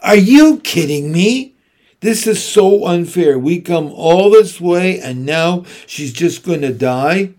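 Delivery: loud, high, furious outburst; a daughter erupting at her mother in the car, yelling; exploding in anger. A narrator's reading. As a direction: blurt it out at the top of her voice, outraged. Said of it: "And then Sam explodes".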